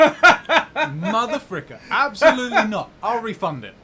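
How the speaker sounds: loud laughing fake fury; slow hammed-up outrage shout; mock outrage.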